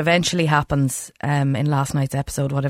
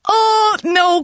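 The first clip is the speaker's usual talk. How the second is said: mock shout; 4 dB louder than usual. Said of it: "you're like".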